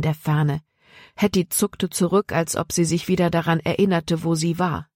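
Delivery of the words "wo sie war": ordinary delivery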